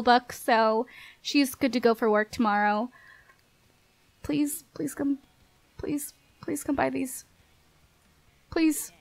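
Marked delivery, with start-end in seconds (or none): 4.2-8.9 soft pleading whisper, trailing away; whispered begging. Real, not acted. Player begging passing shoppers to buy muffins, whispered pleading. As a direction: beg under your breath.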